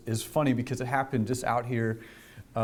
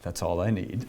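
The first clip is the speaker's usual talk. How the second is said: dry officer; 6 semitones lower than usual. Retold or imitated. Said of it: "and the officer went".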